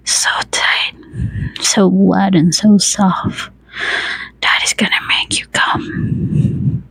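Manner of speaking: soft, mostly whispered murmur; hushed, breath-heavy undertone; strained murmur. A narrator's reading. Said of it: "he murmured".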